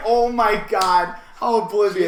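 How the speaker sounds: amused, incredulous outburst; laughing disbelief. Retold or imitated. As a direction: retell your astonishment, cracking up. Retelling own amazed reaction, laughing.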